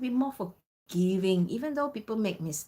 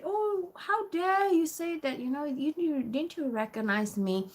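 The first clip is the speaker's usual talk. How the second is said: angry, mildly mimicked; 8 semitones higher than usual. Member scolding staff who didn't recognize her, mock angry. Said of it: "she was really, really angry. And she said".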